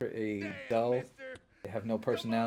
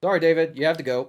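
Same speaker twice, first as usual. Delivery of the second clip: mock dramatic farewell; 4 semitones higher, 10 dB louder than usual.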